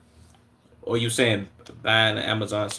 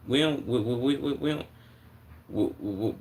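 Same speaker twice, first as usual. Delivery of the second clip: stammering, stuck on the word; repeating the first word, stuck; stuttering. Real, not acted. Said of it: "I'm stuttering".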